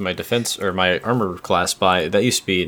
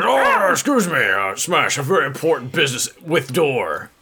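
mock polite brute; 5 semitones higher than usual. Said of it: "I would push them out of the way".

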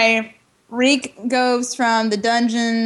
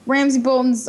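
usual speaking voice

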